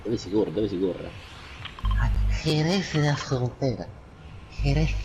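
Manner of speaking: ordinary talk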